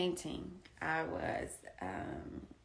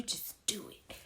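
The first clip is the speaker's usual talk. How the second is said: whispered gently; 7 dB quieter than usual. Retold or imitated. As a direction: breathe the advice out. Quote ends whispered.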